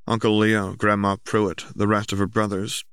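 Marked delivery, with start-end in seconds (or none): none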